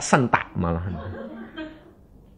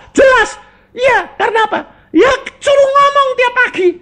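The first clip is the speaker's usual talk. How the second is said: much higher, loud, laughing with joy; excited woman; 9 dB louder than usual. Retold or imitated. Healed woman tells preacher she can talk, overjoyed. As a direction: bubble over with delight.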